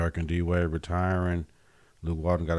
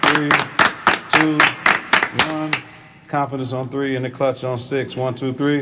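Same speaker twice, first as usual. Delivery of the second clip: pumped-up team count; rally the team on the count; hype countdown; 5 semitones higher, 3 dB louder than usual. Real, not acted.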